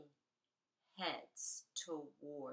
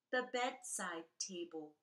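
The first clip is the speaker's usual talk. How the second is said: usual speaking voice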